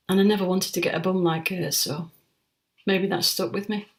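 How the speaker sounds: usual speaking voice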